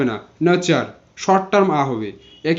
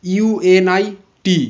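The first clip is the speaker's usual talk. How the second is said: spelling out slowly; much slower than usual. Shown as a demonstration. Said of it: "যেমন unit".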